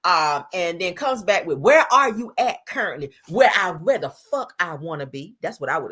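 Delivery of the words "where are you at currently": higher, louder imitated questioning; insistent put-on interrogation; mock demanding. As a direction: press each word like a needy ex. Acts out his demanding text.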